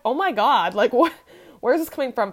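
loud, stunned disbelief; shocked. Retold or imitated. Texting back her ex, loud and shocked. Shocked by ex's nasty texts.

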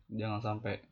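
ordinary talk, nothing marked

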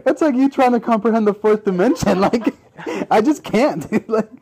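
breathless laughter mid-sentence; laughing. Real, not acted. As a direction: talk while still cracking up. Laughing after a friend's fart joke.

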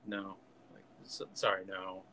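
low, soft, halting refusal; quietly acted bad news, trailing down; Obi-Wan. Obi-Wan tells Padme about Anakin, low soft apology. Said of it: "Obi-Wan's like".

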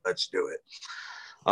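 slow, soft whisper to himself; whispered repeat. Real, not acted. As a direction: breathe it out slowly.

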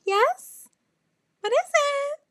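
very high, loud squeal; mock strained composure. Stranded driver bracing for bad news, mock strained. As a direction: squeak it out, feigning barely holding together.

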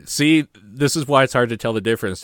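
ordinary talk

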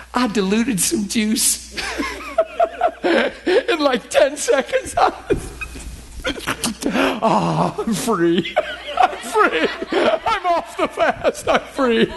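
giddy laughing relief. Retold or imitated. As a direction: laugh out the freedom. Retelling breaking fast joyfully.

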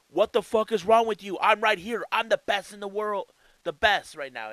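high, loud, furious rant; frustrated vent. Retold or imitated. Ospreay ranting at Moxley for ignoring him, loud angry vent. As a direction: blow up like a wrestler insulted on the mic. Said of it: "Will Ospreay vented his frustrations".

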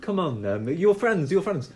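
effusive ringmaster host, accent put on; Italian-accented ringmaster. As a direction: gather guests with theatrical Italian flourish. Plays the ringmaster.

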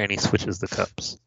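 ordinary delivery

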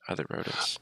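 whisper. Real, not acted. Echoing aside.

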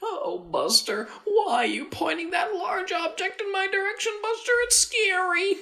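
very high, whimpering mock voice; mock scared Buster voice. Co-host pretending to be frightened in a very high voice. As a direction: squeal it like a scared cartoon.

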